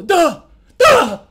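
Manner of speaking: loud, high, rising from first to second; emphatic mocking exclamations; shouted duh. Shown as a demonstration. One more big duh.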